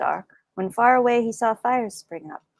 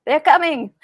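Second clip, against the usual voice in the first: loud alarmed shouts, pitched up; crying out; 6 semitones higher, 7 dB louder than usual. Acted. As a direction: call it out in alarm. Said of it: "men cried".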